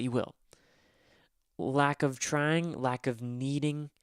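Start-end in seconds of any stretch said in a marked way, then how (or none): 1.6-3.9 slow, weighing each word; slow and deliberate. Real, not acted. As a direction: spell it out slowly.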